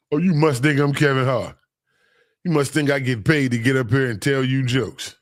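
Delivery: ordinary talk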